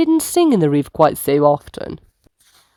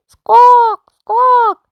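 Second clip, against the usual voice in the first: high, louder squawk; bird squawk; 8 dB louder than usual. Acted. Noisy birds startle the sloth, sharp squawks. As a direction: squawk like a loud jungle bird.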